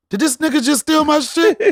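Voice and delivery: put-on high, loud voice of an indignant toddler; outraged toddler. Son glaring at dad on stage, high indignant toddler voice. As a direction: play the kid who just got his joke stolen. Son's imagined outraged look.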